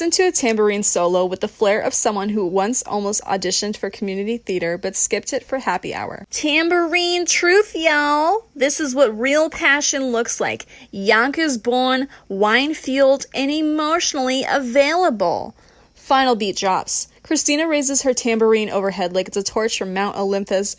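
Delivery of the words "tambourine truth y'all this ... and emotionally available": high, drawn-out theatrical proclamation; brash diva. Acted. Christina testifies to the crowd with tambourine, brash and theatrical. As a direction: declaim it like a tipsy showboat mid-solo. Christina's figure performs her solo.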